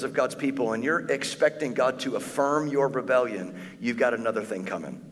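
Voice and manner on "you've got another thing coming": usual speaking voice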